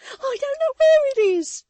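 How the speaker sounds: very high, loud frantic cry; wild exclamation. A narrator's reading. Susan to Walter in panic, wild high cry. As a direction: fling it out wildly. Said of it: "exclaimed Susan wildly".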